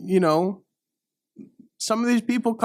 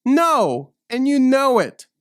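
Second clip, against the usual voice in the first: loud retort; 6 semitones higher, 4 dB louder than usual. Acted.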